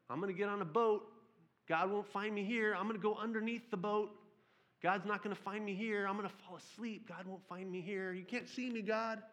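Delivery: put-on high, childish hide-and-seek voice; hiding little kid. Preacher voicing Jonah as a hiding child, high and sing-song. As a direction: play the kid hiding from God. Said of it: "he's that little kid who's playing hide and go seek".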